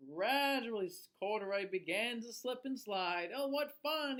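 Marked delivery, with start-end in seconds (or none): 3.3-4.2 higher, smiling delight; with a smile. A narrator's reading. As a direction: say it smiling with glee. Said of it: "he said with a smile".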